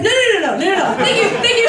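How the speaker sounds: high, loud, tumbling refusals; panicked backtracking. Retold or imitated. Actress retells backtracking with her agent, comic high panic. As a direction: hurriedly take it all back, loud and high.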